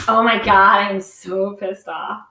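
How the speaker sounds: low, drawn-out pretend annoyance; joking mock complaint. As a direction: read out a joke post as if peeved. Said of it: "So if I write".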